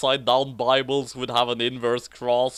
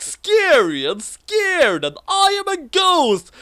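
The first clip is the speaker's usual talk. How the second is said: high, louder spooky words; spooky squeals; 6 dB louder, slower than usual. Acted. Reading spooky lightning words.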